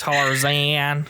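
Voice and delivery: drawn-out "Tarzan" with a stretched "zaaan"; mocking pronunciation. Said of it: "That's how they said I was saying it".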